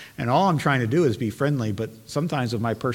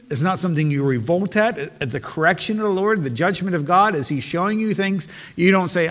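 raised, loud, driving pulpit voice; fervent revival preacher building the crowd; full-force preaching; 4 semitones higher, 4 dB louder than usual. Acted. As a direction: preach it hard to the congregation.

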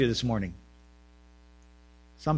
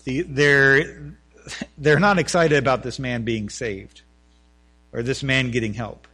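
ordinary delivery

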